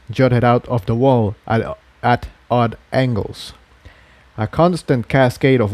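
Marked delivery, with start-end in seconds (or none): none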